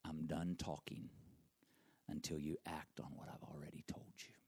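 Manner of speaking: low, soft whisper; hushed whisper of God. Acted. Pastor voicing God's answer to him in a hush. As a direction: breathe God's words quietly.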